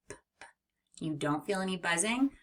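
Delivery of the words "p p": p, p as soft voiceless puffs; demonstrating voiceless p; unvoiced p. Shown as a demonstration. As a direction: puff the p with no voice. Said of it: "say p".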